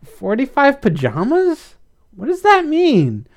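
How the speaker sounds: slow, very high rising question; puzzled, drawn-out high query; confused. A narrator's reading. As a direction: echo it in utter bafflement. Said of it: "Blythe was confused".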